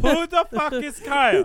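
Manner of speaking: high, loud shout of the question. Real, not acted. To the group, shouted demand.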